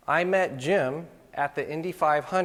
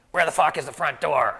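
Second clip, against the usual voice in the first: louder, gruff curmudgeon shouting down the phone; grumpy man yelling; 5 dB louder than usual. Retold or imitated. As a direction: bark it as an annoyed old photographer. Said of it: "he's like".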